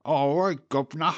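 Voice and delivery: a cheeky cartoon cockney accent, overdone; mock cockney accent.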